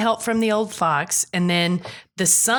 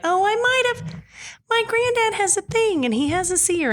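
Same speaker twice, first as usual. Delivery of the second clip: high, squeaky mock-sheepish voice; sheepish confession; 11 semitones higher than usual. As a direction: confess in a high, guilty singsong. Retelling him nervously admitting a secret.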